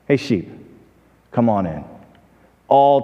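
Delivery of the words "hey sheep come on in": low, soft, unhurried call; very calm. Retold or imitated. Farmer calls his own sheep who know him, very calm. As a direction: say it gently, with a pause in the middle. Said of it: "the farmer said very calmly".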